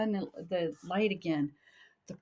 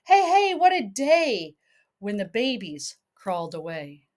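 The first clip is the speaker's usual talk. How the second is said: lilting read-aloud storybook voice; sing-song picture book reading; reading to a child; 2 semitones higher, 5 dB louder than usual. Acted.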